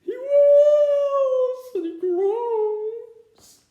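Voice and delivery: high sing-song wailing cry; mock blubbering. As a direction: wail and blubber like a toddler. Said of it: "blubbers on".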